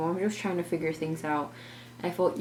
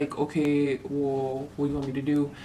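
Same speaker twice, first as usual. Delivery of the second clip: imitating husband; 4 semitones lower than usual. Narrator mimics Justin on the phone, lower.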